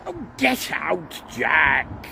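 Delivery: high, slow shout of get out; shooing. A narrator's reading. Douglas shooing Jack from the carriage, high shout. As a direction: chase the cat out loudly.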